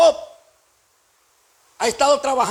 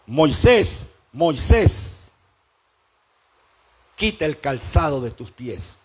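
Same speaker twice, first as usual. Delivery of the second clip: deep, deliberate divine summons; voice of God; 8 semitones lower, slower than usual. Acted. God calls Moses from the bush, solemn voice of God. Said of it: "escuchó la voz de Dios que le dijo".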